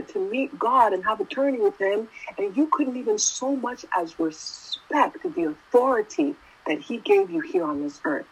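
ordinary delivery

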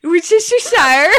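Worcestershire slurred into wish-ish-uh-shire, high and loud; botched quick attempt. Shown as a demonstration. Friend tries another different way, Worcestershire loudly garbled into shushes. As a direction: mangle Worcestershire into a run of sh sounds. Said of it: "say it fast five different ways".

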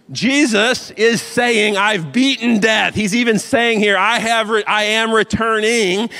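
loud, high, driving sermon voice; fiery preaching. Acted. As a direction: preach it at full force to the congregation. Preacher at full force again.

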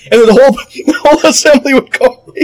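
breaking into laughter mid-sentence, high; can't hold back the laugh. Real, not acted. Laughing at his own Baptist impression with Matt. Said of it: "Okay, Matt, stop it".

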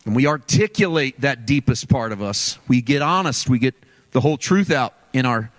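ordinary talk

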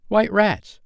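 loud, very high exclamation; inspired cry. A narrator's reading. Nibs guessing at Wendy's story, inspired cry. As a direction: blurt it out as a sudden inspiration. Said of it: "cried Nibs, inspired".

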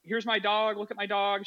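high, chirpy mom; bubbly neighbor showing off her dog; a high voiced friendly mom. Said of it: "and she's like".

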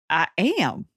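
ordinary delivery

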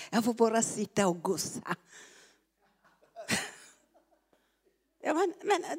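ordinary delivery

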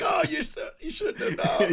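high, loud, laughing fake cry; mock wail. Acting out the wounded reply.

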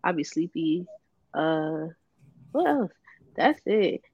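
normal talking voice

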